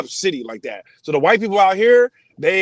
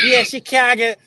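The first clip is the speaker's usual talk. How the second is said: put-on Chicago accent; 7 semitones higher than usual. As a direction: hit it like a Chicago native. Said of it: "heavy Chicago accent".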